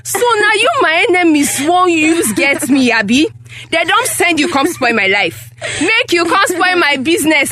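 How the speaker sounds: outraged, lamenting tirade; indignant accusing rant; angry scolding. Acted. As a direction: berate your child as if betrayed. Mother scolds and accuses daughter.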